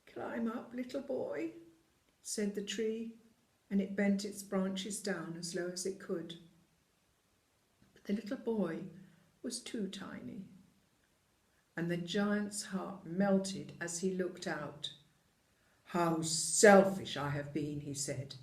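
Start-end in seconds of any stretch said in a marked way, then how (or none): 0.1-1.5 hushed, breathy tree voice; a tree whispering down to a little boy. Acted. 15.9-17.8 slow, loud giant voice; remorseful giant. Acted. Giant realises his selfishness.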